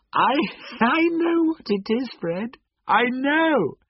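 high-pitched, bursting out with the answer; excited cry. A narrator's reading. Sister guessing Fred's riddle, crying out excitedly. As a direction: cry out as if you've just guessed it. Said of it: "she cried out".

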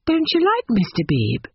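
plain reading voice

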